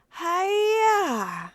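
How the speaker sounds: high, loud karate yell; battle cry. Acted. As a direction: throw it with a fighting shout. Tossing match with a battle cry.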